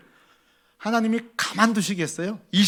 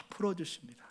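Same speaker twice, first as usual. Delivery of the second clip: 풀어주십니다 breathed unvoiced, falling; unvoiced 풀어주십니다 with a soft fall; whispered; 12 dB quieter than usual. Real, not acted. Soft climax of comfort.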